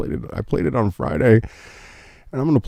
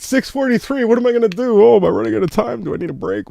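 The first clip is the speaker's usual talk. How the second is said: much higher, fast playful fluster; hammed-up alarm at the clock; high quick mock panic over the time; 13 semitones higher, faster than usual.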